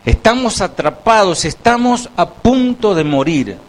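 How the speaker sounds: voz mucho más aguda, exclamando sin esperanza; desesperación actuada. Acted. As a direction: clama como un pueblo atrapado. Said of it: "Ellos decían". (clamor desesperado, agudo, que baja al final)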